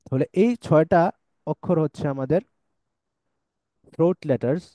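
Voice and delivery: normal talking voice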